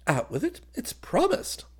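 high, light-hearted assent; laughing agreement. A narrator's reading. Officer promising his cousin a favour, merrily. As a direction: grant it gaily before hearing it. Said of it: "he laughed".

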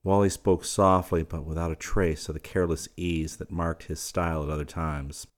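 plain reading voice

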